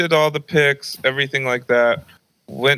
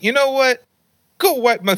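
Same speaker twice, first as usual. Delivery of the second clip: high mock-mom voice; exasperated mom; 12 semitones higher than usual. Mom about to order son to wipe phone, high exasperated imitation. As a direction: imitate a fed-up mother, pitched up.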